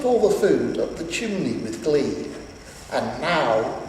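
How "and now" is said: low, sly villain voice; a scheming grin you can hear; grinning Grinch. Acted. Grinch turning to the tree, low sly grin. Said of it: "grinned the Grinch".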